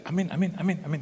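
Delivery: quick, bouncy string of amens; imitated family amens. Preacher mimics wife and children hearing good news, eager chanted amens. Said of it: "anak saya dan istri saya".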